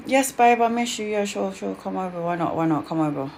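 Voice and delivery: quiet, sinking half-hearted yes; reluctant agreement. Retold or imitated. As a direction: give in grudgingly, voice dropping away. Grudgingly giving in to daughter's visit.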